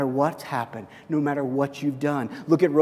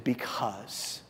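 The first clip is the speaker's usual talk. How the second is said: God's voice, hushed; 6 semitones lower, 14 dB quieter than usual. Retold or imitated. Pastor voicing God's gentle reply to him, hushed. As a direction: speak it like a quiet answer from above. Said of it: "And he said".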